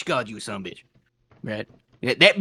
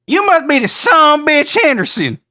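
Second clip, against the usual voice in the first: higher, louder put-on voice; mock accusing drawl; 8 semitones higher, 7 dB louder than usual. Adventurer greeting the farmer with the quoted insult, higher and louder. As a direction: hurl the insult like she did. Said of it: "Is that how she said it?".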